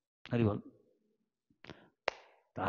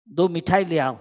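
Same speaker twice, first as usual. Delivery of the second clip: loud call across the room; calling out for sweets; 4 semitones higher, 10 dB louder than usual. Retold or imitated. Guru calling loudly to bring sweets for the guest. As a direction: raise the voice to send for sweets.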